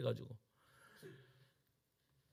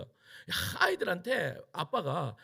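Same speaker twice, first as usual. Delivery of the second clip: ordinary delivery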